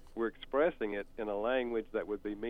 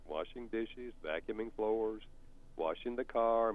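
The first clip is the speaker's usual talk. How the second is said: normal talking voice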